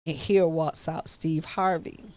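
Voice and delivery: ordinary talk, nothing marked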